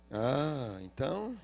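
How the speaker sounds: soft, low "ah, então"; quiet realization. Retold or imitated. Father quietly realizing the truth about the money. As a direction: let it drop, half to himself.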